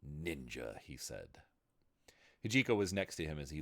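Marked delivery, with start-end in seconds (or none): 0.0-1.4 low guttural growl. A narrator's reading. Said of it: "low and guttural like a growl".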